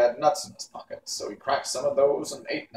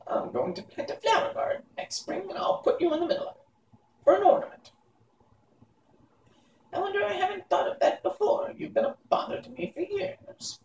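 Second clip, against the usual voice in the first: high, cackling wicked crone; gloating witch. Acted. Witch Mombi gloating to boy Tip, high and cackling. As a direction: relish every cruel word.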